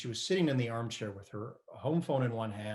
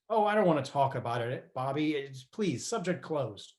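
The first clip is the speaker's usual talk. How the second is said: retold outburst; 3 semitones higher, 6 dB louder than usual. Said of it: "how I shouted at you".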